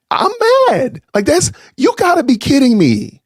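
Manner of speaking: can't believe it, voice climbing high; worked-up outrage. Real, not acted.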